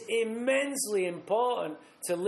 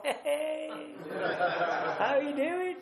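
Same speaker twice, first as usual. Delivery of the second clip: higher, chirpy put-on charm with a laugh; fake friendly greeting; 8 semitones higher, slower than usual. Greeting the barmaid, breezy fake-casual charm. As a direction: play it sweet and casual to the barmaid. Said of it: "and I go".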